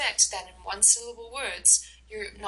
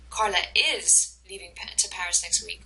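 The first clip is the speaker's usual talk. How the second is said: "is" emphasized, the rest falling away; stress on IS. Shown as a demonstration. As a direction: put the main stress on "is". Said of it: "depending on what word you emphasize in the phrase".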